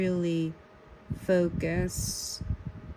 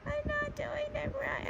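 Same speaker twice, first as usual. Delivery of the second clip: much higher, softer, fast squeak; squeaky high whine; 7 dB quieter, faster than usual. Real, not acted. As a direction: squeak it out in mock despair. Laughing at own drawing.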